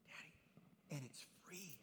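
hushed, unvoiced little-girl whisper; whispered child voice. Pastor whispering his daughter's words to dad. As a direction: breathe it like a small child's secret. Daughter's wise remark retold.

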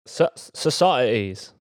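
slow mock Cockney "societies"; imitating Cockney. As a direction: copy the Cockney vowels in "societies".

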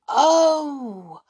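high, loud drawn-out realisation; excited cry. A narrator's reading. Children suddenly understand the trees, crying out. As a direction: cry out as it dawns on you. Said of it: "cried the children all at once".

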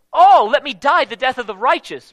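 preacher crying out the verse; fervent proclamation. Retold or imitated. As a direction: proclaim it with fire. Re-quoting the prophecy.

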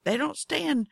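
ordinary delivery

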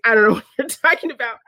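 quick, high-pitched mock denial; flustered denial. Retelling the caught rock star denying show tunes, flustered. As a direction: rattle off a hasty retold denial.

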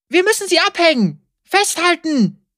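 high urgent mother voice; mother shouting. A narrator's reading. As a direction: call out to hold on. Mother shouts from wagon front.

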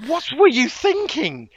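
high, incredulous mock scolding; comic outraged disbelief, pitched high; exasperated disbelief. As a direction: demand it in baffled exasperation. Mock-berating the film's makers.

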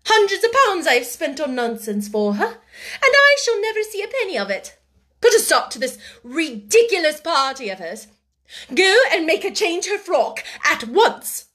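high, indignant complaint turning to command; loud, outraged scolding; furious rant. A narrator's reading. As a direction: rail at her, then order sharply. Furious woman ranting and ordering.

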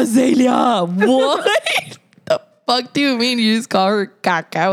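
high, outraged parent with laughter creeping in; shocked scolding. Retold or imitated. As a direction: scold the kid in exaggerated disbelief. Acts out scolding the child.

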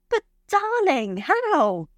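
high-pitched, disbelieving plea; distressed pleading. A narrator's reading. Rejected lover pleading with him.

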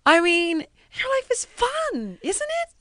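high, slow sing-song imitation of the exec; mocking executive. Imitating the network boss asking if her life is fun. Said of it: "so he's like".

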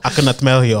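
laughing mock Russian accent; hammed-up garbled Russian accent. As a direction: mimic a bad Russian accent through laughter. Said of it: "What accent the fuck is that?".

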